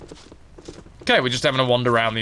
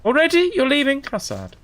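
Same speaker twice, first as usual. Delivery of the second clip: lightly voiced sultry rogue; sly assassin Anishai; 13 semitones higher than usual. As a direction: tease the stranger like a flirty thief. Anishai again.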